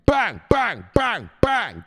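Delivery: high, loud rapid-fire exclamations; shouted bangs. Pretend. Adding forgotten blows, loud high repeated shouts. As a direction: fire off each bang as a shout.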